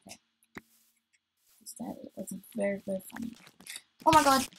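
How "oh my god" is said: sudden loud, higher exclamation; startled. Real, not acted. Player killing a friendly by accident, startled cry. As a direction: blurt it out in shock.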